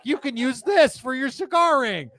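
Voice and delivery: high, loud chirpy computer helper voice; mock paperclip assistant. Poking fun at cigar ring art, high helper voice. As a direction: pitch it up like a cartoon desktop assistant. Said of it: "Macintosh computer was like".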